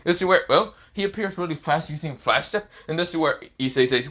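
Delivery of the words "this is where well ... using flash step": plain reading voice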